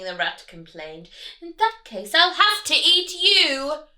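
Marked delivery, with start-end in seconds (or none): 1.4-3.9 loud, higher sneering villain; bully them like a greedy rat; big villainous rat; highway rat voice. Acted. Rat threatens duck.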